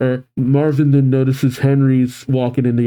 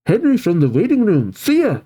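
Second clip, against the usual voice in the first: high, perky and eager; bubbly, pitched-up girl character; excited; 10 semitones higher than usual. Acted. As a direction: chirp it to a friend. Casey excitedly leads friend off.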